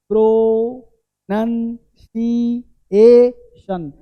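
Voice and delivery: "प्रोनंसिएशन" as PRO... NUN... SI... E... SHUN; very slow, long gaps inside "प्रोनंसिएशन"; syllable-by-syllable demo. Shown as a demonstration. Teacher has students watch each part, syllable-by-syllable demo. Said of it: "देखो क्या".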